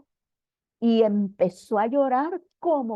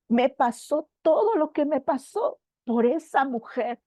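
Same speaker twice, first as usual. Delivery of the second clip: ordinary talk, nothing marked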